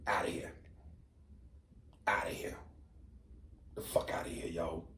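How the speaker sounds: very soft, low whisper; whispered dismissal. Pretend. Father dismissing daughter's imagined boyfriend, whispered. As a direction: whisper it in disbelief, with long pauses.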